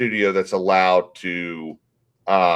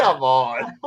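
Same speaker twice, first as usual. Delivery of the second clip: ordinary delivery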